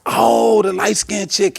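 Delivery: high, loud "oh" of realization; dawning recognition. Retold or imitated. Comic finally placing the singer, high exclaimed recognition. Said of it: "And I was like this".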